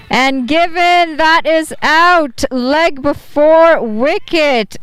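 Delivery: high, slow, building wicket call; excited cricket commentator. Real, not acted. Wicket falls, live call.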